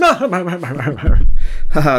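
loud, high hearty haha; pompous British laugh. Acted. Officer laughing at the blimp joke, loud pompous haha. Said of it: "very pompous, uh, British laugh".

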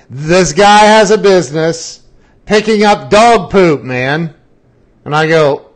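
emphatic lecture. Retold or imitated. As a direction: drive the point home hard. Friend lecturing loudly.

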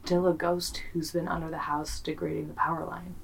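quiet spooky suggestion to her cohost; spooky hush. Acted. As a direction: drop to a hushed, eerie voice. Spooky ghost theory to cohost.

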